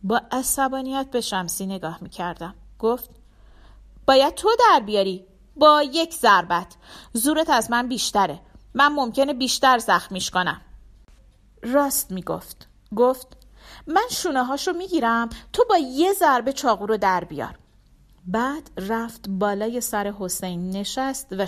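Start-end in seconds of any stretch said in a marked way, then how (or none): 4.1-10.6 louder, higher urgent woman's voice; tense whispered-room urgency turned loud; Shamsi urgent. Acted. Narrator voices Shamsi's urgent orders. 13.9-17.6 louder, higher urgent woman's voice; tense whispered-room urgency turned loud; Shamsi urgent. Acted. Narrator voices Shamsi's plan.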